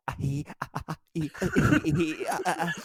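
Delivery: halting ah-hee noises climbing high; silly animal-call imitation; imitated fox yelps. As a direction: yelp staccato syllables, rising in pitch. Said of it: "you were like".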